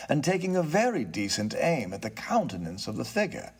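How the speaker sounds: ordinary delivery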